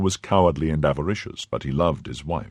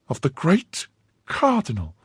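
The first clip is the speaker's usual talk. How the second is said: high, incredulous wife's echo; 12 semitones higher than usual. Acted. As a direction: she can't believe her ears. Wife incredulous.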